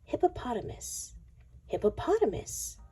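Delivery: usual speaking voice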